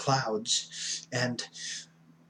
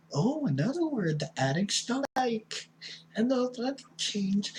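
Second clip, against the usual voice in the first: mock whining; 10 semitones higher than usual. Mimics addicts who hate the word change, whining. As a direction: whine it like a put-on baby.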